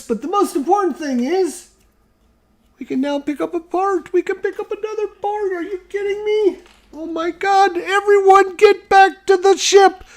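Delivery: very high excited squeal; rally everyone in a giddy squeak; squealing excitement. Pretend. Excited rallying after picking up parts.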